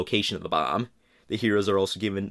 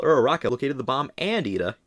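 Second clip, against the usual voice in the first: normal talking voice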